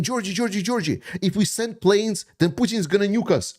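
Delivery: high mocking voice of a panicky doubter; mocking critics. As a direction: ape the people who always warn him, pitched high. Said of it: "And then".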